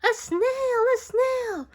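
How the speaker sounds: high, loud shocked shout; teacher spotting the snail on the board; startled cry. A narrator's reading. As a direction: gasp it out in alarm.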